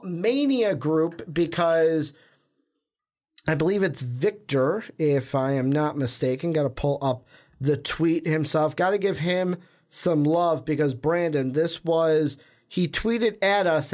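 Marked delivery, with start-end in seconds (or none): none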